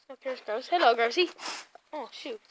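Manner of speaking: ordinary delivery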